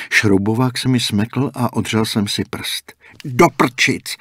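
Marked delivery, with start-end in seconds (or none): 3.2-4.2 louder, higher sudden curse; yelled curse. A narrator's reading. Dan scrapes his finger and yells a curse. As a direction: yelp it as the screwdriver slips. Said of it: "zařval jsem".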